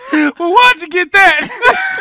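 loud, drawn-out mock irritation; irritated. Man grilling her over the grocery shopping, irritated. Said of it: "He's been irritated".

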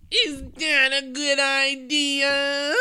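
high, loud, drawn-out mock question; mocking Joey J impression. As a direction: blare it out slowly on one high pitch. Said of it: "Joey J says".

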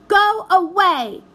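put-on high, loud, slow childish shout; girl shouting at her uncle. A narrator's reading. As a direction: yell it over your shoulder. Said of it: "shouted the blue vision".

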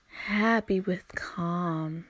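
slow, stretched happy with calm; slow, drawn out. Shown as a demonstration. Advising her listeners, she drags out happy with calm slowly. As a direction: let each word of happy with calm linger, slowly. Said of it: "repeats the phrase two ways".

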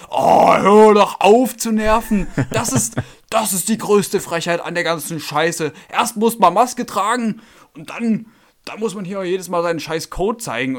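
nachgeäffter, hoher, lauter, langsamer Wutausbruch; äff den pampigen Kunden laut und gedehnt nach; wütender Kunde. Retold or imitated. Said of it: "Krass, hat er sich so aufgeregt". (Kunde am Testzentrum motzt über Code, gespielt wütend)

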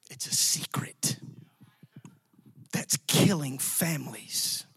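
hushed secret whisper, slowed. Real, not acted. Preacher to his congregation, a slow hushed whisper. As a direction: lean in and whisper it slowly.

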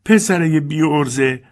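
slow, loud scolding cry; Dilsey yelling at Lester in the kitchen; shout. Acted. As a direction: scold the boys at full voice. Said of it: "دیلسی فریاد زد".

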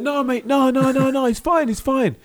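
high, loud, pleading lad voice; high mock chav. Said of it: "He's like".

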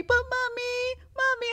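put-on high, drawn-out child's plea; imitating her kid. Kid runs to mom after daddy says no, pleading child voice. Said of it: "comes running over to me".

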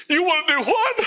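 high, incredulous "you wanna what"; stunned disbelief. Retold or imitated. To the pastor offering fuel, stunned disbelief. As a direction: ask it like you can't believe your ears.

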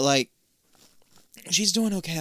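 ordinary talk, nothing marked

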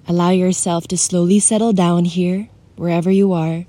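soothing guide for someone feeling alone; guided meditation. Acted. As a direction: ease the listener into stillness.

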